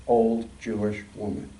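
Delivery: usual speaking voice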